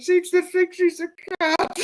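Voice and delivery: very high laughing squeal; squealing with laughter. Real, not acted. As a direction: squeak it out through helpless laughter. Squealing laughter at cat answer.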